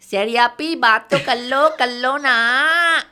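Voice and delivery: put-on loud, high-pitched pleading kid voice; high kiddie whine, stretched at the end; imitated child relative. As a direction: mimic a kid's voice note, dragging out the end. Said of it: "this is how he used to talk".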